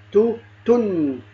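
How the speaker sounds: "تو، تن" slowly as too, then tunn; "تو، تن": long oo, then u with a drawn-out n; tanween damm drill. Shown as a demonstration. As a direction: say "تو، تن" slowly, humming the last n. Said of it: "وكذلك باقي الحروف".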